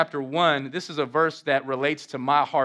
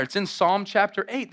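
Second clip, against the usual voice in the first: usual speaking voice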